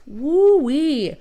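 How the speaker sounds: high, loud excited whoop. Real, not acted. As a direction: cheer it out. Excited about the close title race.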